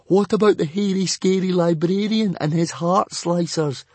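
put-on boy objecting nervously; anxious boy. A narrator's reading. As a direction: raise the alarm.

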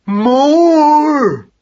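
one long, high, loud cry; sustained high-pitched demanding yell; great yell. A narrator's reading. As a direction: bellow the word and hold it. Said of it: "yelled Magnus Power Mouse".

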